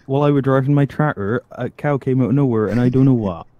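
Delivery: put-on loud, broad rural farmer accent; hammed-up country farmer telling a tale; mock farmer accent, loud. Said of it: "I've been told I can do farmer".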